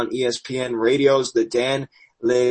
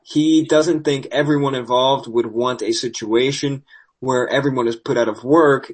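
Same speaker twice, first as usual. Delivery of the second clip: ordinary talk, nothing marked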